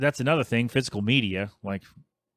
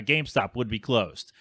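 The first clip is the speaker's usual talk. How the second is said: normal talking voice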